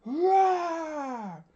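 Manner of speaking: high, loud soldiers' war cry; loud battle scream. A narrator's reading. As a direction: roar like a whole army raising swords. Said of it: "with a loud scream".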